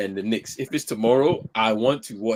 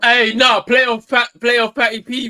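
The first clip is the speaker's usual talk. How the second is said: loud, high excited shout; 10 semitones higher, 9 dB louder, slower than usual. Real, not acted. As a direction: hype him up loudly. Excited defence of Patty Mills.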